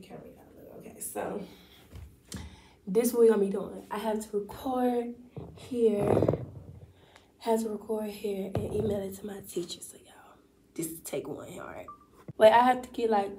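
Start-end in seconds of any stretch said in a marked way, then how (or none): 0.0-1.4 hushed whisper to the camera. Real, not acted. Whispering while recording at home. 9.8-11.9 hushed whisper to the camera. Real, not acted. Whispering while recording at home.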